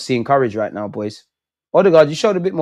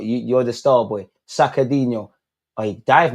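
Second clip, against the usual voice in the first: ordinary delivery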